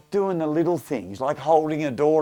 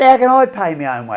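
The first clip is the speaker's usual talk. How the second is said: protesting daughter; 7 semitones higher, 9 dB louder, faster than usual. Retold or imitated. Daughter protests paying herself.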